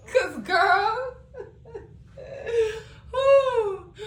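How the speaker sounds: high squealing laughter; high breathless laugh; laughing fit. Real, not acted. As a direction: let the laugh take over. Laughing after drive-by joke.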